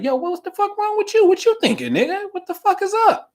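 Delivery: high, indignant demand; mock outraged quote. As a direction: mimic someone fuming at God's choice. Imitating someone confronting God.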